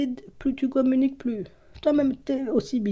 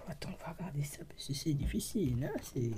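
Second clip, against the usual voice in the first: a very soft unvoiced whisper to herself; 6 semitones lower, 15 dB quieter than usual. Real, not acted. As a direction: hush it, concentrating on the cards. Murmure en se concentrant sur cartes. (chuchoté, très bas, concentrée)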